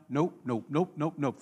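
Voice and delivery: low, soft repeated refusal; unbothered king saying no under his voice; dismissive refusal. Retold or imitated. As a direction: brush them off quietly, over and over.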